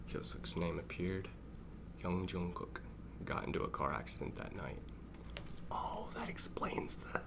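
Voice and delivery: soft, hushed reading close to the mic; half-whisper. Real, not acted. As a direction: keep it quiet so as not to yell. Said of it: "Why are you whispering".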